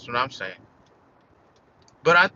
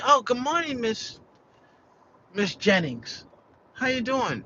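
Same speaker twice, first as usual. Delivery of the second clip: cheery greeting; 7 semitones higher than usual. Retold or imitated. He greets Miss Jennings cheerily.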